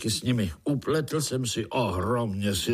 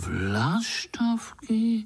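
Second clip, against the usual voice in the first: high, long-drawn question of disbelief; astonished; 12 semitones higher, much slower than usual. A narrator's reading. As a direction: stretch the word out in wonder. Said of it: "podivili se pavoučci kolem". (udiveně, vysoko a protáhle)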